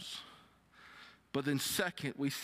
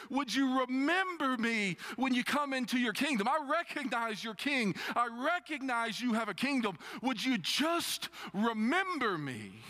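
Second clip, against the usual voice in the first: simple plea; 9 semitones higher than usual. Retold or imitated. Criminal asking Jesus to remember him, earnest plea. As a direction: plead openly and simply. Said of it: "looks at Jesus and goes".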